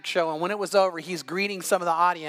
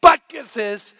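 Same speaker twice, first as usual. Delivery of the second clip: loud, defiant retort; loud comeback in the argument; 2 semitones higher, 9 dB louder, slower than usual. Retold or imitated. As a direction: fire it back loudly. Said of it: "I said".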